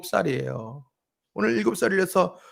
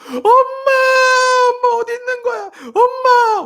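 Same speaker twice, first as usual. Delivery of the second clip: much higher, louder crying wail for mom; imitating a child crying; 12 dB louder than usual. Imitating his daughter, eyes shut, crying loudly for mom. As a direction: play a sobbing child calling mom. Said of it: "울고 있는 거예요".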